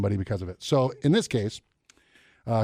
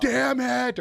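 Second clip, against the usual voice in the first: frustrated gamer yell; 12 semitones higher than usual. Retold or imitated. Gamer raging at the controller.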